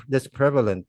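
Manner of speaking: ordinary talk, nothing marked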